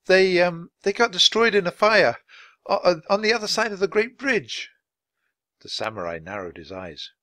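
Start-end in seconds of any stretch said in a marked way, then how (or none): 0.1-4.7 put-on bright girl's voice, higher, a little flustered. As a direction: Annie nervously explains about the passports. Annie explains to the samurai.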